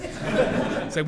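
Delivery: normal talking voice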